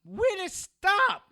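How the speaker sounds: high, loud, slow yell of stop; alarmed shout. Acted. Man shouting at his friend to stop hurting the woman.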